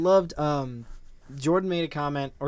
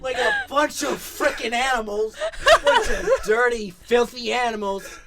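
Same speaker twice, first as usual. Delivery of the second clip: much higher, louder words cracking with laughter; laughing rant; 11 semitones higher, 5 dB louder than usual. Real, not acted. Defending his tears to friends, laughing rant.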